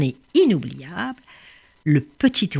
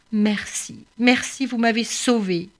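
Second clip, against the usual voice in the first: a touch of tears in grateful thanks; choked voice; 5 semitones higher than usual. Acted. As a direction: thank them through a tight throat. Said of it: "d'une voix toute étranglée".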